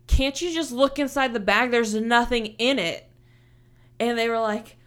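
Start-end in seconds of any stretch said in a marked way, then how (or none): 0.1-3.1 annoyed retort. Retold or imitated. Arguing with airport security.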